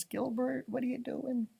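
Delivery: softly calling out to the character; a soft, playful call to Gilbert; teasing aside to the character; hushed call. Real, not acted. Reader playfully addresses the character.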